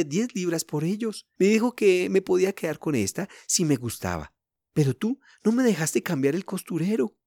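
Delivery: put-on little girl protesting. A narrator's reading. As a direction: plead with mum in a girl's voice. Narrator voices child Elsie.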